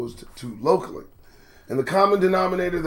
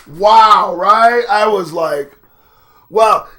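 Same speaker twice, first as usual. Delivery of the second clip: loud, higher amazed exclamations; excited wow; 4 semitones higher, 11 dB louder, much slower than usual. Real, not acted. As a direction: sell how stunned you were. Recalling shock at the video.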